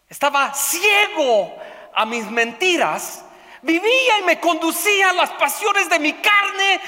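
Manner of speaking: loud, high, impassioned preaching shout; full-force pulpit confession of sin; fiery preaching. Acted. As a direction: preach it at full force, crying out his past sins.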